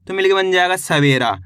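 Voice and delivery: ordinary talk, nothing marked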